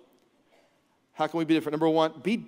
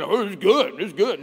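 caveman voice; 3 semitones higher, 7 dB louder than usual. Retold or imitated. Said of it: "I'm sure that's how they talked".

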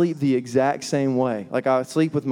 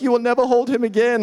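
higher-pitched freak-out as a distraught wife; mock weeping; 7 semitones higher than usual. New mother breaking down at husband, mock weeping. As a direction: imitate a tearful wife, pitched up. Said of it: "She's weeping and is like".

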